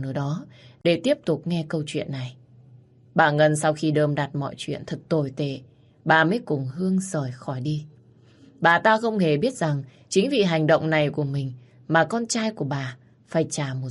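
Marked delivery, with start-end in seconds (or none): none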